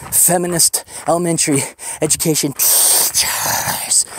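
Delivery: whispered, slow, sneering aside; hushed sneer. Retold or imitated. Voicing God, he whispers a mocking list of the teachers. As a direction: breathe it out like a dirty secret.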